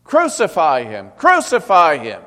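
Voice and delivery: escalating mob shout; crowd shouting. Acted. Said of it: "they shouted".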